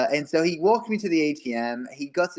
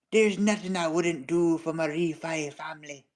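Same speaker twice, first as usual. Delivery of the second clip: put-on Irish accent; 4 dB quieter than usual. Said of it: "In the peak Irish accent".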